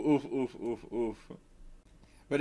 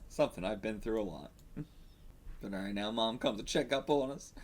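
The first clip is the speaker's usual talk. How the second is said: normal talking voice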